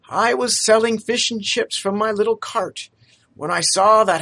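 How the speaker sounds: put-on a poor young man, eager to please; lightly voiced humble lad; street lad Topper. Topper explains rescue.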